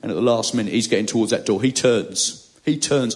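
usual speaking voice

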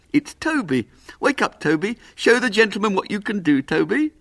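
high, lively voice of a little puppet man; eager little showman, proud. Acted. Little man showing his dog to the boy, proud. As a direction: show off your beloved dog with pride.

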